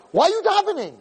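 very high-pitched scolding; high, incredulous mock yell; imitated yelling. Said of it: "Hashem's screaming at him, he's yelling at him".

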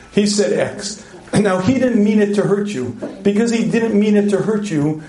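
usual speaking voice

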